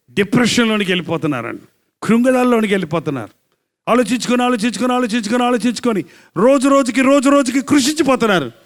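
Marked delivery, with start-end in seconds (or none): 2.0-8.5 high, insistent repetition; full-force preaching. Acted. Preacher describing people wasting away from brooding, full-force preaching. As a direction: pile up the repeats and keep the pitch raised.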